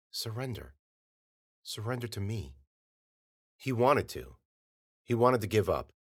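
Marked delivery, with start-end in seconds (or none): 0.1-2.6 soft, hushed persuasive voice; coaxing wife. A narrator's reading. The false Melanie coaxes Bennett to let go, softly. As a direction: gently lure him to give in.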